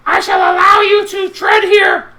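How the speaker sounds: booming, high, shouted goblin-lord greeting; a grandiose shout of welcome to his domain; Little G greeting. Acted. GM voices Little G greeting loudly.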